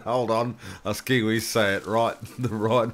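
a loud, laughing Kiwi correcting; imitating John. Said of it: "John saying".

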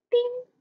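high, bright ting sound; idea ding. Acted. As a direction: ring out a sudden light-bulb ting.

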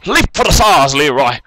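high, loud rallying cry; rousing call that drops at the end; urging on. Real, not acted. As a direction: cheer your game character into the jump. Cheering on the game character.

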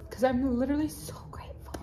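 real choked-up gratitude, fading to whisper; emotional, tearful. Real, not acted. Vlogger overcome with gratitude to followers, tearful and fading. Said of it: "I am emotional".

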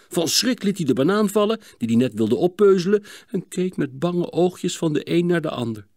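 usual speaking voice

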